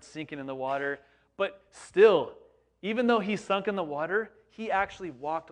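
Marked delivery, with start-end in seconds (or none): 1.4-5.5 louder, higher insistent push; emphatic burst. Real, not acted. Preacher defending Peter to the congregation, loud and emphatic. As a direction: drive the point home.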